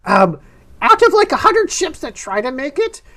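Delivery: animated shopkeeper with high-pitched leaps; excitable map seller. Acted. Map seller advising travellers on the way out, excitable. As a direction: voice a lively merchant, swooping up high.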